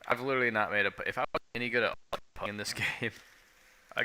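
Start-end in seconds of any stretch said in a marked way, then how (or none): none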